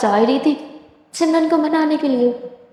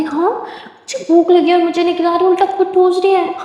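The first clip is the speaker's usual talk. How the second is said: higher, whiny grumble; sulky complaint; 6 semitones higher than usual. Acted. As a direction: pout about being left hungry. Sulky complaint while watching. (भूखी रहकर चिढ़ते हुए ऊँची शिकायत)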